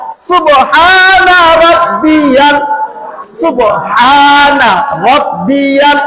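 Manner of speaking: সুবহানা রব্বিয়াল drawn out slowly in recitation; slow recited phrase. Shown as a demonstration. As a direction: chant সুবহানা রব্বিয়াল slowly for students to copy. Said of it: "হরকতের উচ্চারণ তাড়াতাড়ি".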